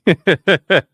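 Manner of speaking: short, loud rhythmic chuckle; laugh. Real, not acted. Speaker amused by neck-popping bit, loud chuckle.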